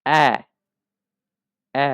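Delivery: "অ্যা" said as an open eh; A with two dots, modelled "অ্যা"; German A-umlaut. Shown as a demonstration. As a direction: drill "অ্যা" as one isolated vowel. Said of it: "এটার প্রনাউন্সিয়েশনটা হবে অ্যা".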